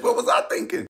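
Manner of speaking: high shout at himself; shouted self-reproach. Real, not acted. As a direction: yell it in disbelief at yourself. Exclaims at his own folly.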